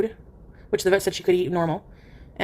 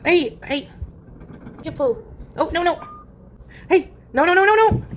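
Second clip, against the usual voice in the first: alarmed no; 8 semitones higher than usual. Real, not acted. Cat pulling, owner cries out.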